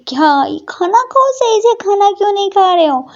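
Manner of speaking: put-on high girlish scolding voice; sister scolding. A narrator's reading.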